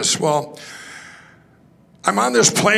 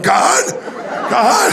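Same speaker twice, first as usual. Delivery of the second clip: crying out to God; 6 semitones higher, 4 dB louder than usual. Retold or imitated. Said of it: "and I'm crying".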